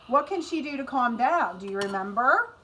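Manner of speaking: normal talking voice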